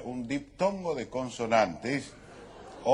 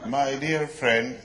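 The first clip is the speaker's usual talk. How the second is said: pompous address; 3 semitones higher, 6 dB louder, much slower than usual. Acted. Formal address in mock English.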